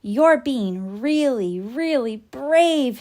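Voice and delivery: slow, reassuring praise; encouraging. A narrator's reading. Doc reassures Boomer, slow encouraging praise. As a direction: soothe a scared patient.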